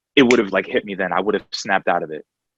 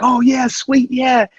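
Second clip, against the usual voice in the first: mock excitement; 14 semitones higher, 4 dB louder, slower than usual. Told insurance accepted him.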